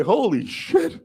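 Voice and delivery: high-pitched astonished holy shit; high stunned exclamation. Retold or imitated. Boy stunned by his mother's bluff, high and amazed. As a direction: gasp it out in disbelief. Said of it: "I'm like".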